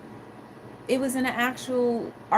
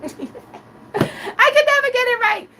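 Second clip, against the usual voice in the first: laughing; 13 semitones higher, 7 dB louder than usual. Real, not acted.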